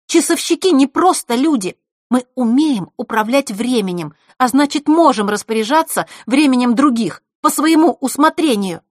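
high, loud proud argument; haughty anger. Acted. Scene: Zakhara boasting of clockmakers' power, loud and high. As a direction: lecture her down from above.

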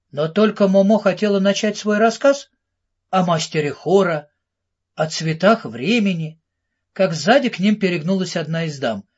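ordinary delivery

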